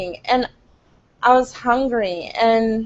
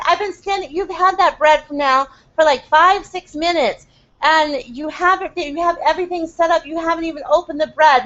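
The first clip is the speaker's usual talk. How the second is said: higher, exasperated mock yell at the worker; reliving losing patience, pitched up and scolding; retold yelling; 5 semitones higher than usual. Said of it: "I started yelling at him".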